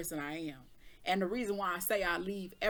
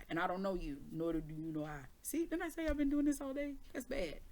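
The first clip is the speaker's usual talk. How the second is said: ordinary talk, nothing marked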